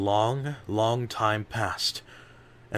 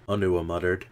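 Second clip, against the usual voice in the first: ordinary delivery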